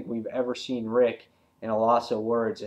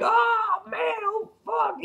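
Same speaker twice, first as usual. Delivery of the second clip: much higher, strained cry of pain; slower than usual. Retold or imitated. Guy bitten in the crotch, high pained yelp. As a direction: yelp it out like he's being bitten. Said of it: "And the guy's like".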